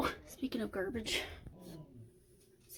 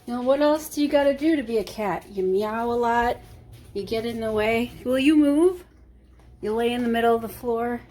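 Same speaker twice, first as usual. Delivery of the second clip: ordinary delivery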